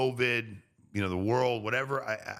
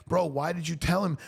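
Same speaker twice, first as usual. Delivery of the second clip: high-pitched, incredulous questioning; exasperated challenge; 8 semitones higher than usual. Acted. Retelling his reply to a complaining friend, exasperated challenge. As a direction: challenge a friend in mild disbelief. Acted rebuke of complaining acquaintance.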